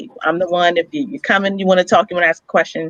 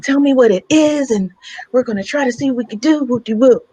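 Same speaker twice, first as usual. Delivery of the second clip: high, sing-song imitation of the doctor; higher mocking voice of the nurse; 7 semitones higher than usual. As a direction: mimic her falsely helpful tone. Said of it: "She says".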